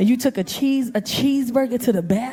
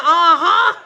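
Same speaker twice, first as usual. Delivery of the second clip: loud, pointed agreement; loud uh-huh; 3 semitones higher, 8 dB louder than usual. Retold or imitated. Comic agreeing with what she yelled, loud uh-huh. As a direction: belt out a knowing uh-huh. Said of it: "I'm like".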